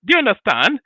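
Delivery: usual speaking voice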